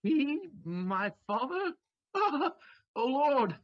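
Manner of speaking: laughing in shock; disbelieving laugh. Acted. Son laughs at finding his father, stunned. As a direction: burst out laughing at the news.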